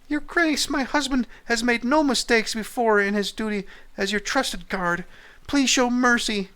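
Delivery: high-pitched wife begging mercy for her husband; pleading wife. Acted. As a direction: beg the lord in a high put-on woman's voice. Wife begs king for mercy.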